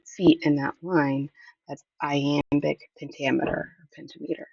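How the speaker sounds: ordinary talk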